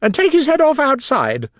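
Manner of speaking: high, loud royal command; imperious Queen. A narrator's reading. Queen ordering an officer to behead someone, imperious and shrill-high. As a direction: order it at the top of your range.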